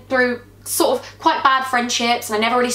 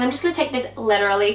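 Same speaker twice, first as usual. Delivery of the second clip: put-on American accent; faster than usual. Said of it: "without doing it in an American accent".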